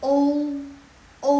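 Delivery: "O" loud and high, closing to ou; audible U ending. Shown as a demonstration. Teacher shows both vowels, clear ou. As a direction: let the U ring at the end of "O". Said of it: "Tapi U-nya lebih kedengaran".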